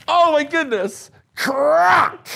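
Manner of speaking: high, loud cry of crack; thrilled shout as the egg cracks; excited. Acted. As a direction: burst out with the big crack. Egg hatching climax.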